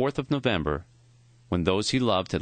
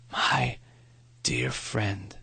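weak, dying voice; much slower than usual. A narrator's reading. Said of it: "His voice was thin and raspy".

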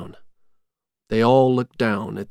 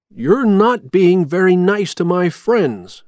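raised, higher voice of protest; reproachful; 7 semitones higher, 5 dB louder than usual. A narrator's reading. Jack confronting his friends' captor, indignant reproach. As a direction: scold him, voice lifted and louder.

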